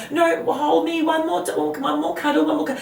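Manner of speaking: put-on high pleading little-kid voice; mock whiny toddler pleading; clingy child begging.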